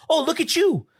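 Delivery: high-pitched sarcastic comeback; mock indignant outburst. Imagined comeback to the parents, high sarcastic indignation. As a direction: play an indignant rant, voice jumping high without shouting. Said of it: "just yell and be like".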